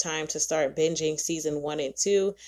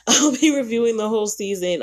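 a laughing, louder insist that "be reviewing" still happens; laughing, louder promise; 5 semitones higher, 6 dB louder than usual. Real, not acted. To listeners after admitting she'll binge, laughing loud promise. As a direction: laugh then say it louder and higher, "be" leaned on.

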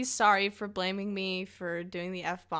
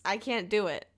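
ordinary delivery

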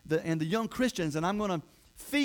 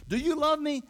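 high, insistent asking; pressing question; 9 semitones higher, 3 dB louder than usual. Acted. Replays Jesus questioning Peter.

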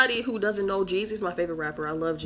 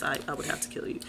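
soft whispered confession; whispering; 4 semitones lower, 7 dB quieter, faster than usual. Pretend. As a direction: whisper it like a secret.

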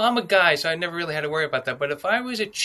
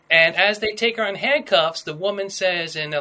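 ordinary delivery

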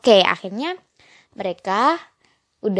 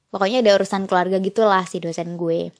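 ordinary talk, nothing marked

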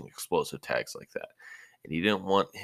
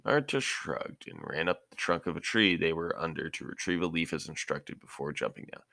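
ordinary delivery, nothing marked

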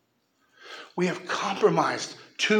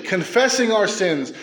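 ordinary talk, nothing marked

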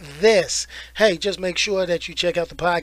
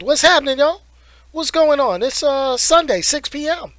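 excited; 7 semitones higher, 4 dB louder than usual. Real, not acted. Hyped stream opener.

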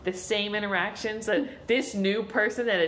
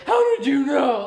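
loud, higher aggressive demand; raised-voice confrontation; 5 semitones higher, 8 dB louder than usual. Acted. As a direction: burst out accusingly. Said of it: "Like, some aggressive".

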